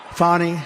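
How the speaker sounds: "Fani" drawn as FAH-nee, lightly French; a little French accent. Shown as a demonstration. Speaker repeating the name to the crowd, "Fani" as French-tinged FAH-nee. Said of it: "a little French accent there".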